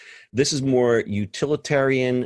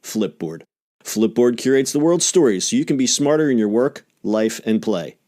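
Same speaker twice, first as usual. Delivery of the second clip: polished, emphatic sponsor read; crisp promotional announcer delivery; 3 semitones higher, 5 dB louder than usual. Acted. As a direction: sell it like a podcast commercial.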